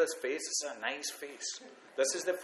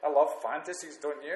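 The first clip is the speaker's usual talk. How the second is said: normal talking voice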